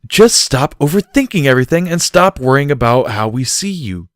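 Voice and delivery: firm exasperated demand. A narrator's reading. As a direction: insist he stop it now. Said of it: "Barry demanded".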